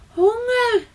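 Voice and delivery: high chirping chick voice; hungry baby bird. A narrator's reading. Baby sparrow begging his parents for food, high chirps.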